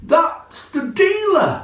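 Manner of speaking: high, slow mock-indignant squawk; answering in an exaggerated high voice; dealer voice. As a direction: stay in the high dealer voice to explain.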